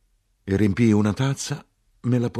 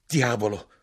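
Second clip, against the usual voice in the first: understated burst of anger; mild angry oath; dismayed, angry exclamation; 3 semitones higher than usual. A narrator's reading. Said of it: "con vero sgomento o vera rabbia, esclamai".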